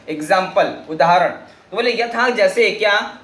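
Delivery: normal talking voice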